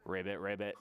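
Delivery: low frog "ribbit"; deep, playful frog noises; frog croak. Pretend. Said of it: "We learned frog".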